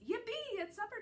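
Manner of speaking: high, bright-eyed cheer; excited yelp. A narrator's reading. Dog cheering his supper bowl, high excited squeal. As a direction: squeal it like a thrilled puppy.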